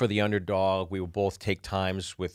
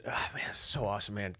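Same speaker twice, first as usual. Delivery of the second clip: whisper; 8 dB quieter than usual. Retold or imitated.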